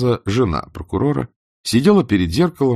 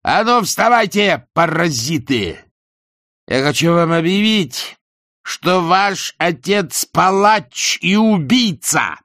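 put-on high, loud drunken yell at the kids; 10 semitones higher, 4 dB louder, slower than usual. A narrator's reading. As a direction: bellow to wake the children. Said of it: "заорал". (пьяный крик на детей)

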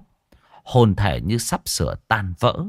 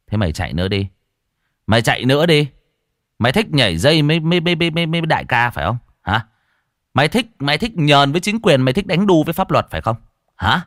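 louder, fast scornful taunting; mock the beaten ghost; 6 semitones higher, 4 dB louder, much faster than usual. A narrator's reading. Cậu Tẩy taunts the captured ghost, loud and scornful.

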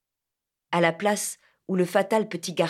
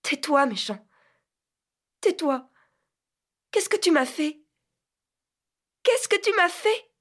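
put-on much higher, tearful girl's reproach; high tearful outburst, rising on the last; 9 semitones higher than usual. A narrator's reading. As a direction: cry it out as a hurt girl. Tearful angry girl reproaches.

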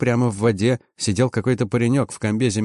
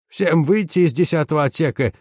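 higher, husky order at moderate volume; hoarse shout; 5 semitones higher than usual. A narrator's reading. Chief mechanic evacuates tenth compartment, hoarse shout. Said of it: "крикнул он хриплым голосом".

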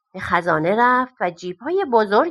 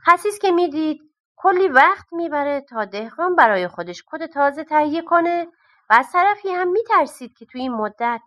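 ordinary delivery

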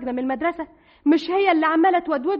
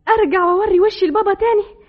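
louder, higher disbelief; horrified outcry; 4 semitones higher, 8 dB louder than usual. Acted. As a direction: recoil at the thought. Horrified at going home. (استنكار فزع)

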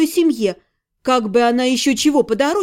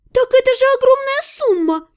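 high, moaning protest; groaning; 12 semitones higher than usual. A narrator's reading. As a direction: wail it in dismay. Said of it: "застонала Тария".

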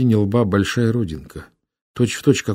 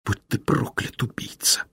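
softer, broken hoarse curse; 6 semitones higher, 5 dB quieter than usual. Acted. Dying sister curses her brother, hoarse and soft. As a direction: rasp the curse out with no voice left. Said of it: "Закончила она сипло".

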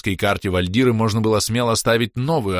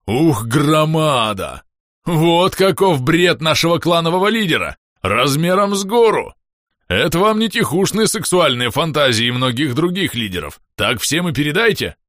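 excited; 7 semitones higher, 4 dB louder than usual. Acted. Bom's excited tirade.

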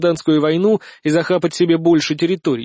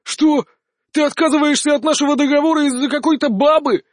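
much higher indignant cry; can't believe it; stunned outrage; 10 semitones higher than usual. A narrator's reading. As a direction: Ivan shocked his ally betrays him. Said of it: "выпучил глаза офонаривший паренёк".

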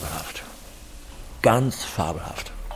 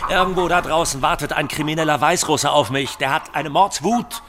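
higher and louder, agitated rant; loud desperate rant; angry shouting; 6 semitones higher, 8 dB louder than usual. Acted. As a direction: yell out your panic and anger. Shouting about the Belarusian creditor.

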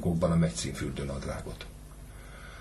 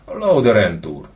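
louder, slow, drawn-out scorn; slow mocking repeat; 4 semitones higher, 9 dB louder, slower than usual. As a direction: drag each syllable out in contempt. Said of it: "bólogatott csúfondárosan Suhajda". (lassan, gúnyosan elnyújtva)

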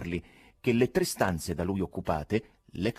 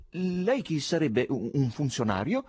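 slow, doubting question; suspicious; 7 semitones higher than usual. A narrator's reading. Chairman asks if he's an official, wary suspicion. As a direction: probe him warily, slowly. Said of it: "disse con sospetto".

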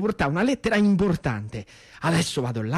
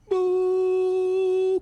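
high droning buzz of a warplane; imitated plane roar; 12 semitones higher than usual. Boy mimicking a warplane roaring overhead, high buzz. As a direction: drone it like a fighter plane overhead.

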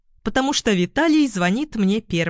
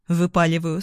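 ordinary delivery

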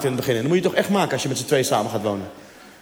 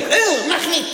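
very high, squeaky disgusted cry; disgusted cleaner; 12 semitones higher, much slower than usual. Retold or imitated. Claudia pushing away the husband's kiss, very high squeaky disgust. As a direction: recoil in mock disgust.

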